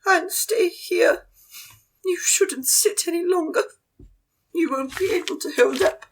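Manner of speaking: put-on a posh elderly lady pleading. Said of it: "And Madame Jean just goes".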